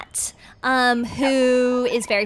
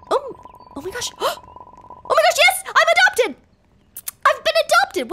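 overjoyed; 12 semitones higher than usual. Real, not acted. Dog learning Granny adopted her, high joyful squeals. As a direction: gasp and shriek with delight at being chosen.

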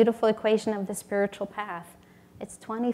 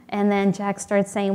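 ordinary talk

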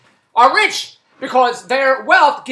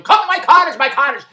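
mock boastful invite; 5 semitones higher, 5 dB louder than usual. Mimicking boastful cottage owner.

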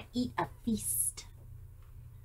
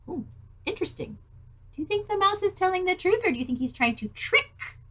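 usual speaking voice

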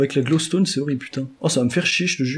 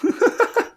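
very high-pitched giggle; high squealed laugh. Real, not acted. Player jumping to be seen by his friend, high squealed laugh.

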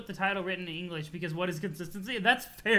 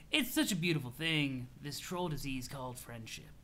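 low, soft, serene stoner voice; laid-back troll, dreamy; 6 semitones lower, 6 dB quieter than usual. Acted. Troll muses to friend on friendship, dreamy and laid-back. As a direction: let it float out, low and gentle.

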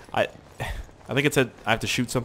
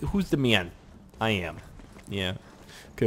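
normal talking voice

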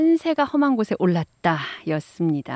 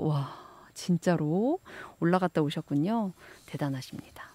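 breathy admiring whisper; 5 semitones lower, 8 dB quieter than usual. Real, not acted. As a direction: whisper in awe. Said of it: "admiring a listener".

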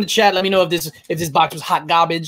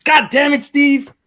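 mock outrage; 11 semitones higher, 5 dB louder than usual. Mock outrage at the dollar book.

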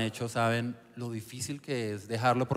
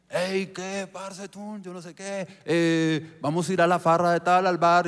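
friends inviting; 5 semitones higher, 3 dB louder, slower than usual. Retold or imitated. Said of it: "empezaron a llegar a la casa".

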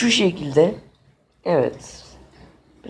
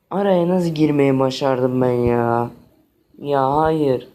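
normal talking voice

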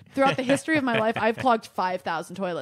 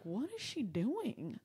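hushed; 11 dB quieter, slower than usual. Retold or imitated. Whispered aside watching mom.

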